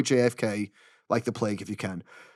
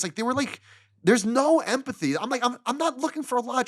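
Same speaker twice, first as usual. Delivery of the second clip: exasperated venting; 11 semitones higher than usual. Real, not acted. Venting about no empathy.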